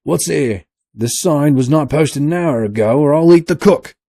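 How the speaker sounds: loud, high rough seaman voice; rough sailor. A narrator's reading. Sailor blustering to his mates, loud and rough.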